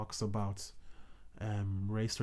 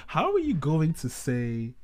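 laughing; 4 semitones higher, 9 dB louder, slower than usual. Real, not acted. Breaking into laughter at interruption.

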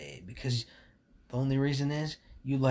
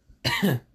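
loud coughing fit; 3 semitones higher, 10 dB louder than usual. Real, not acted. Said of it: "it went down the wrong pipe".

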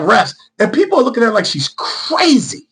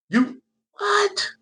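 incredulous squeak; 13 semitones higher, 9 dB quieter than usual. Real, not acted. Incredulous reaction.

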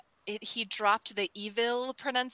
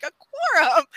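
higher, louder disbelieving repeat; incredulous echo; 7 semitones higher, 7 dB louder than usual. Retold or imitated. Tony repeating the chief's word, higher and disbelieving. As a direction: throw the word back in disbelief. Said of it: "And Tony's like".

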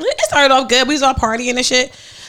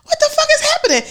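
very high, incredulous squeal; mock shock; faster than usual. Retelling the group's reaction to a sudden fight, in shrieky shock. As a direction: squeal it in acted disbelief.

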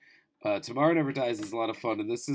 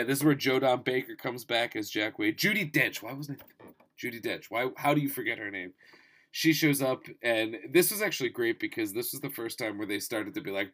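usual speaking voice

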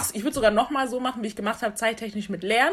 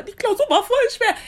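mock whine; 12 semitones higher than usual. Mocking her own complaint.

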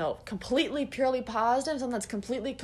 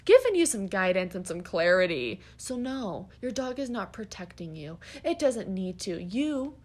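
usual speaking voice

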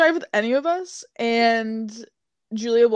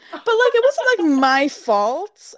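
defensive protest; 7 semitones higher, 5 dB louder than usual. Retold or imitated. Defending herself to the examiner.

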